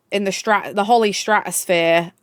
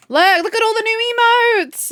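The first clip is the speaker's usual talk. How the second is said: excited; 11 semitones higher than usual. Real, not acted. Excited streamer showing emotes.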